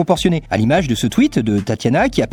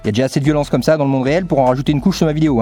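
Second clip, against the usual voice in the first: fast rant; faster than usual. Real, not acted. Rapid rant.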